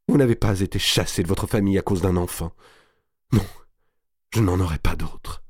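understated moan, broken by pauses; moaning grief. A narrator's reading. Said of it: "gémissait-elle". (plainte gémissante)

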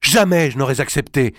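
a queen's indignant outcry; exclaiming. Acted. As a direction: cry out in shocked protest. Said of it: "s'écria Clémence".

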